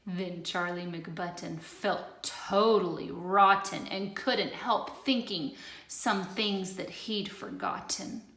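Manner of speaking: usual speaking voice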